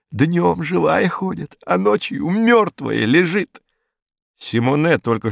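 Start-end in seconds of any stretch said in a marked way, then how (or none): 0.1-3.6 higher, slow, spooked crook voice; a superstitious thug spelling out the horror; eerie Hinkus. A narrator's reading. Hinkus spooking the inspector, slow and high.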